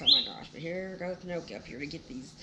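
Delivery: ordinary delivery, nothing marked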